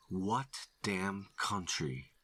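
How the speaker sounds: slow, pressing, angry; slow angry demand. A narrator's reading. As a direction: bite each word. Threatening repeat of question.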